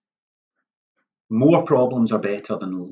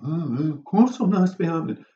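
imagined sceptic; 7 semitones higher than usual. Pretend. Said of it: "you might think".

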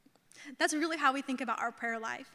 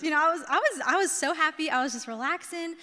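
usual speaking voice

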